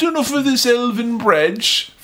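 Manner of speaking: high, slow, drawn-out earnest hobbit; mock-heroic quote. Sam to Frodo about the bread, mock-heroic. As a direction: stretch it out high and solemn. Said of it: "he's always very, uh".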